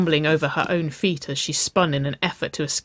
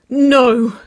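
loud, high pleading cry; begging; 9 semitones higher, 11 dB louder than usual. A narrator's reading. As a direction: plead with a drawn-out, high exclamation. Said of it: "she begged".

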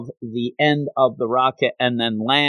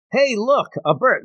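high-pitched mock excitement; fake distraction; 11 semitones higher than usual. Officer distracting his colleague before bolting, high and falsely excited. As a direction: point out a decoy so you can slip away.

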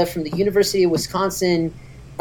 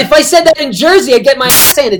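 loud and higher, laughing while talking; laughing hard with the podcast host; 6 semitones higher, 8 dB louder than usual. Real, not acted. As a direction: push the words through real laughter. Laughing through the joke.

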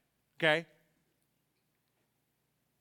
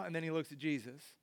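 usual speaking voice

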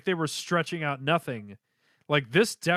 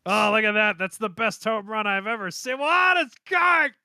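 excited; 6 semitones higher, 4 dB louder than usual. Acted. Acting out the baseball scene.

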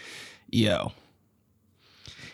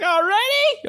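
surprised aunt; slower than usual. Retold or imitated. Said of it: "She's like".

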